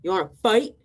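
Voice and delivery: grouchy ladybug. Acted. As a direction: pick a fight as a grumpy bug. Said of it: "the grouchy ladybug said".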